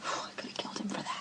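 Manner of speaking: unvoiced bitter whisper; whispered confession. Cindy admitting her anger at Will, in a whisper. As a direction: breathe it out like a dark secret.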